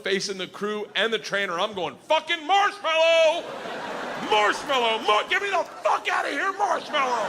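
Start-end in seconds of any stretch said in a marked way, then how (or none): 2.1-7.3 high shrieking yells for help; desperate laughing panic shouts; panicked scream. Retold or imitated. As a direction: scream the safe word at the crew. Bear grinding, screaming for rescue.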